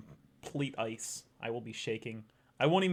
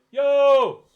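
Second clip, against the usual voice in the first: much higher, much louder call across a distance; demo yell; 17 dB louder than usual. Shown as a demonstration. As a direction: shout it to someone far away. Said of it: "That's a yell".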